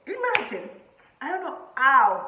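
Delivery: high, loud, stretched exclamation; amazed disbelief. Real, not acted. Telling viewers she can't believe she said yes, high and loud. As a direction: marvel at it out loud.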